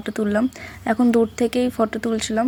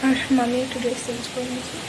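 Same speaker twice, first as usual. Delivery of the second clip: speaking softly; 6 dB quieter than usual. Real, not acted. Vlogger whispering distance from sleeping relatives, speaking softly. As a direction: keep it down so the sleepers aren't disturbed. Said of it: "একটু আস্তে আস্তে কথা বলছি".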